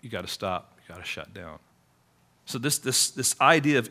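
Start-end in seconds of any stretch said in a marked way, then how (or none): 0.0-1.6 quoted order, dropped much softer and lower; hushed warning quote. Retold or imitated. Preacher quoting authorities shutting a ministry, much softer and lower. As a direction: lower the voice for the outsiders' threat. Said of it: "their people were gonna come in and say".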